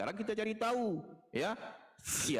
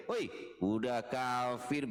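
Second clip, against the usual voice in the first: normal talking voice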